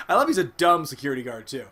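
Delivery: laughing through the words. Real, not acted. Laughing at the dumb security guard on SmackDown. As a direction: giggles spilling into the line.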